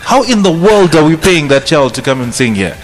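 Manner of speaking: usual speaking voice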